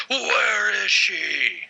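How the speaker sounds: slow gravelly Batman yell, high; Batman shout. Retold or imitated. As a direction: bellow it out like Batman. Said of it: "we can compare Batman voices".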